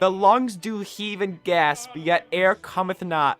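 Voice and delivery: slow, grand olde-tongue reading; Old English recital. Acted. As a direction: recite it like a Shakespearean herald.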